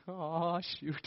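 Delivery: very soft, low "oh shoot"; dismayed mutter. Retold or imitated. Boy hearing his father's call, soft dismayed mutter. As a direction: drop your voice like a caught kid. Kid realizing he's in trouble.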